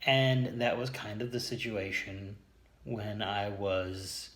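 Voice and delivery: ordinary delivery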